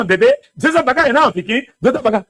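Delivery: ordinary talk, nothing marked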